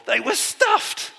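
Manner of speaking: high, slow, overfull groan; comic stuffed voice. Pretend. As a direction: say it like you ate too much. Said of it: "That's Luke for".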